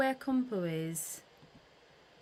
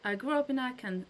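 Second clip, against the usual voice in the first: ordinary talk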